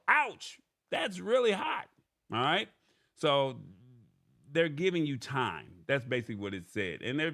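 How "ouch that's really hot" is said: high, yelped reaction to a burn; expressive exclamation. Acted. Host reading a sample phrase for voice recording, expressive yelp. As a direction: act out the pain, pitched way up. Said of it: "with the occasional expressive".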